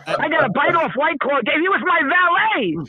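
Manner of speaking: high-pitched mock outrage at being upstaged; worked-up, high-pitched grumbling for laughs; comic indignation. Real, not acted. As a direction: complain like the overshadowed star. Comic complaint about being upstaged.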